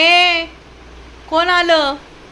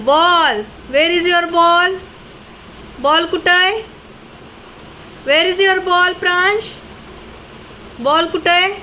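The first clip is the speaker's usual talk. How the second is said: high, bright coaxing questions; 4 semitones higher, slower than usual. Real, not acted. Mother urging her toddler to bring the ball, high and sing-song.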